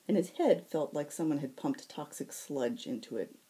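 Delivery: usual speaking voice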